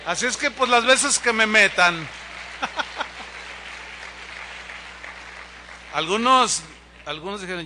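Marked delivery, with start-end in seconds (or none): 2.0-5.9 quiet, airy laugh; soft laugh. Real, not acted. Pastor laughs softly after joking about jail, quiet airy laugh. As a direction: let a soft laugh trail off.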